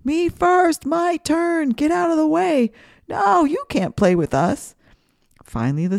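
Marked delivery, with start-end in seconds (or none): 0.0-4.7 high, loud bossy yells; shouting kids. A narrator's reading. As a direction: shout it like a greedy kid grabbing the swing. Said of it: "you could hear his snake student shouting".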